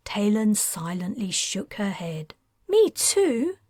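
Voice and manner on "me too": protesting. A narrator's reading. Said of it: "Kayla protested".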